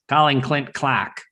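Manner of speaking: slow Aussie male phone-assistant voice, "Clark" as "Clack"; Aussie accent. Retold or imitated. Siri answering a caller in an imitated Aussie accent. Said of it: "I chose an Aussie male".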